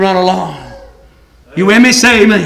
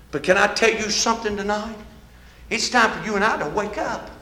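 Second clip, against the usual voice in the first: quiet closing appeal; 14 dB quieter than usual. Real, not acted. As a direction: bring the congregation gently to stillness. Preacher's soft closing appeal.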